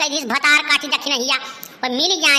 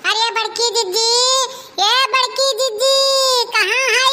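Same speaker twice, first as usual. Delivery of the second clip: high, carrying call across the yard; sing-song calling; 7 semitones higher than usual. Acted. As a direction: call for the elder sister from outside. Calling out to sister from outside.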